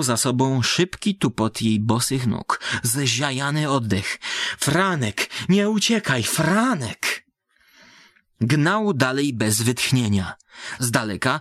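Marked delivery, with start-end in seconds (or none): 4.6-7.2 higher, pleading cry after him; desperate calling. Acted. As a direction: call him back, begging. Mad girl calls after fleeing man. (błagalne wołanie obłąkanej dziewczyny)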